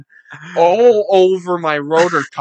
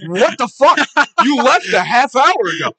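higher, louder shocked yelling; retold outrage; 9 semitones higher, 6 dB louder than usual. Yelling at the friend found in his closet, shocked and angry. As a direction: catch him in the act and blow up at him.

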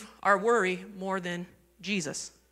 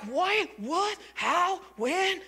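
high, soft burst of panicky questions; mock freak-out; 6 semitones higher, 5 dB quieter, much slower than usual. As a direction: imitate a mild panic, pitched up and hushed. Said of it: "And I'm freaking out".

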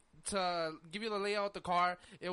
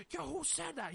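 higher-pitched retold demand; imitating tough guy; 8 semitones higher, 4 dB quieter than usual. Kid confronting the front passenger, acting tough. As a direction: mimic a street kid confronting someone. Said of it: "he's acting tough, like, real, like, real street".

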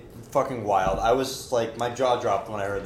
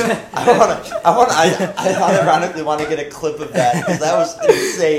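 talking through real laughter; can't stop laughing; 3 semitones higher, 6 dB louder than usual. Real, not acted. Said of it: "word vomited so hard".

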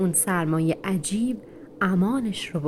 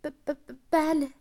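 high, soft stuttered yes, small with fear; scared stammer; 8 semitones higher, 3 dB quieter, slower than usual. Acted. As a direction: barely get the word out. Terrified puppy answers the dog. (لکنت از ترس، با صدای زیر و آرام)

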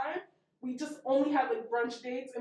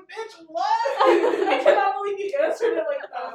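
higher-pitched, laughing disbelief; mock outrage; 7 semitones higher, 6 dB louder than usual. Owner who perfected customer service to partner, mock outraged. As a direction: play appalled while laughing. Retold outrage at the phone blunder.

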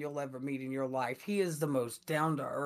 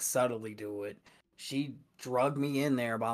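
ordinary talk

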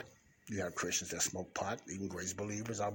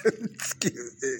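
laughing; 6 semitones higher, 8 dB louder, slower than usual. Real, not acted. Laughs after calling it rant.

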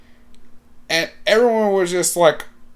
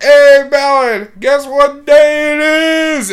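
loud, high, slow call to the crowd; rally the whole student section; yelling out; 9 semitones higher, 7 dB louder, slower than usual. Retold or imitated. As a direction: hype man shouting across the gym. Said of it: "and I yell out".

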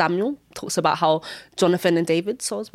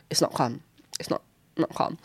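hushed; 2 semitones lower, 7 dB quieter than usual. Real, not acted.

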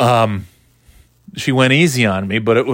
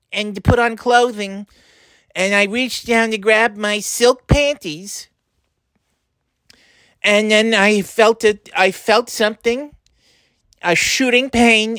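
put-on high old grandma pleading for help; whiny elderly grandmother calling her grandson; 5 semitones higher than usual. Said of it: "it's your grandmother".

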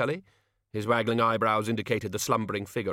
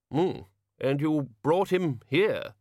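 slow, higher, sceptical wizard; doubtful wizard; 6 semitones higher, much slower than usual. A narrator's reading. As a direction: drawl it with doubt. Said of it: "said Ridcully".

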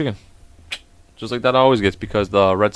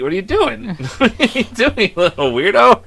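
laughing tease; 9 semitones higher, slower than usual. Real, not acted. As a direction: laugh through the teasing question. Teasing shy child, laughing.

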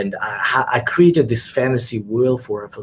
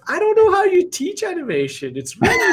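very high, mocking child voice rising up; cheeky daughter. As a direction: tease the dad like a sassy kid. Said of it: "She goes".

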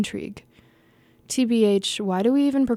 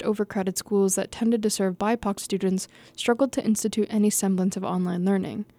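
usual speaking voice

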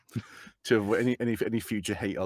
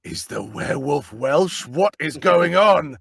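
drawn-out, louder exclamation of disbelief; thrilled, incredulous outburst, retold; astonished delight; 3 semitones higher, 7 dB louder, slower than usual. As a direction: stretch it out and let it swell loud. Retelling own thrilled reaction.

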